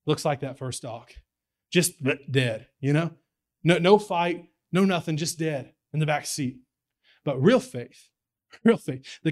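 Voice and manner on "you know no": ordinary delivery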